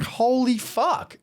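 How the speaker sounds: slow, high, stretched-out amazement; amazed. Retold or imitated. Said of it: "And I'm like".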